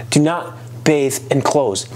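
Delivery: usual speaking voice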